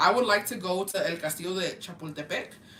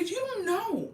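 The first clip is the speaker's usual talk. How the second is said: sassy retort; 12 semitones higher than usual. Retold or imitated. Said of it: "And I was like".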